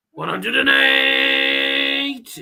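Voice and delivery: long drawn-out caller shout; darts caller voice. Pretend. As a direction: stretch it out like a darts MC. Mimics darts caller announcing 180.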